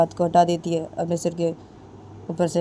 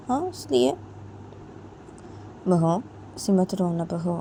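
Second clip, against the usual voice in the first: put-on higher, pleading older woman's voice; coaxing mother-in-law; 5 semitones higher, 3 dB quieter than usual. Mother-in-law coaxes the crying daughter-in-law, higher, pleading. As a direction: plead with her to stop crying.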